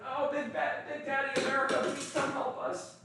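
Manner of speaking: high-pitched helpless little voice, hammed-up; put-on high voice, begging for rescue; mock scared pleading. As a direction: plead like a frightened weakling, mockingly. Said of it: "Then it's like".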